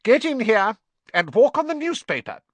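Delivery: high, loud shrieked order; high-pitched scolding yell; calling out sharply. A narrator's reading. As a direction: summon him crossly from indoors. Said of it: "heard Aunt Petunia calling him".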